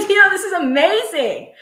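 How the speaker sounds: loud, high laugh into delighted words. Real, not acted. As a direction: burst out laughing with joy. Delighted by wearing socks live.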